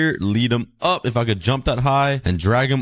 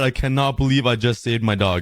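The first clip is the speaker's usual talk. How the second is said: ordinary delivery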